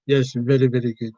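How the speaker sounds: put-on comic Indian accent with tapped r's; caricatured Indian accent. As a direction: play an agreeable Indian-accented clerk. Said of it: "generic Indian character".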